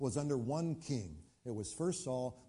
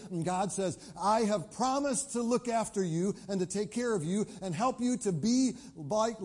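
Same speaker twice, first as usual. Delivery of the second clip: higher, louder, impassioned preaching; preaching at full force; 6 semitones higher, 5 dB louder than usual. Acted. Preacher urging the congregation to ask God, impassioned. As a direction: rouse the congregation, full sermon fire.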